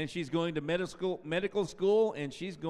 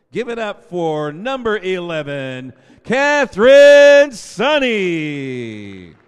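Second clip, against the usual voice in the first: drawn-out ringing name call, much louder and higher; announcer call; 4 semitones higher, 19 dB louder, slower than usual. Real, not acted. Announcer calls the next senior to the crowd, long ringing shout. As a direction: stretch the name out for the gym.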